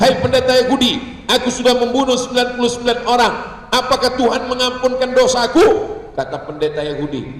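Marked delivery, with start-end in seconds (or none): 0.0-5.9 a lightly acted urgent confession, pitched up; killer addressing priest. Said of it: "Dia datang kepada pendeta Yahudi".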